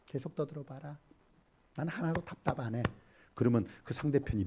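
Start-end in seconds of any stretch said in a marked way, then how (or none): 0.0-2.8 unbothered listener. Acted. Listener not frustrated at all by the rant, unbothered and quiet. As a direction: let them rant, answer very softly. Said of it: "듣는 사람, 상대편은".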